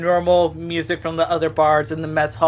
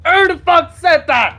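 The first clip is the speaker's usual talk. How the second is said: put-on high, loud drunk snarl; angry drunken yell; 11 semitones higher, 5 dB louder than usual. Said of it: "and then back in Draconic".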